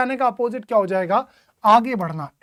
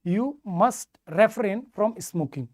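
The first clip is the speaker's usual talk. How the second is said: very slow, every word spaced; slow correct version; much slower than usual. Shown as a demonstration. Teacher slowly reading the corrected sentence to students. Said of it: "fix preposition आती है from".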